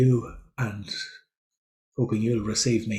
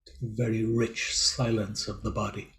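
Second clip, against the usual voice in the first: measured meditation guidance with long, silent pauses; guided meditation. Real, not acted. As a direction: leave space after each phrase, guiding listeners into body awareness. Leads a silent-day meditation.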